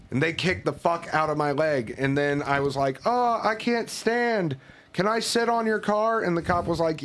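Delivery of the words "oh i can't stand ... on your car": mock pained plea. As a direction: play the hurt victim for the cop. Faking injury to the cop.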